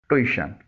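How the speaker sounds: ट्यूशन said as "too-ISH-un"; careful ट्यूशन as "tu-i-shun"; correct pronunciation. Shown as a demonstration. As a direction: say ट्यूशन with an "oo-i" glide, no y sound. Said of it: "सही pronunciation यानी तलफ़्फ़ुज़ है".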